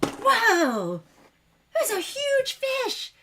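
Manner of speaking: high mock shout, stretched out; high-pitched, drawn-out holler of wonder; retold excited shouting. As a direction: imitate him calling out in amazement. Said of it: "like, shouting out".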